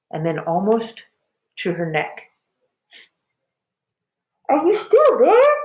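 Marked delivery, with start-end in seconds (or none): 4.5-5.5 high, loud strained creature voice; dragon voice. A narrator's reading. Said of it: "the voice asked".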